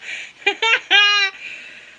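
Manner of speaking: high, loud cackle; sudden burst of laughter. Real, not acted. Reader cracking up after the sad act, loud laugh. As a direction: break character with a big laugh.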